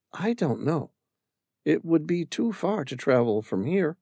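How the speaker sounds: put-on a young woman remembering her husband; Amira's voice. Amira telling the stranger about her late husband, wistful.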